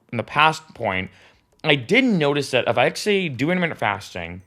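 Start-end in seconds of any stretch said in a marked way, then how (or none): none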